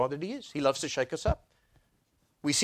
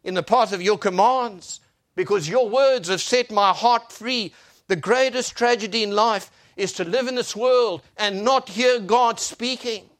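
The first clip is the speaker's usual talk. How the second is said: loud, higher preacher's delivery; impassioned preaching; 10 semitones higher, 10 dB louder than usual. Real, not acted. Preacher declaring God's word to the congregation, impassioned preaching. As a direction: proclaim it with full force.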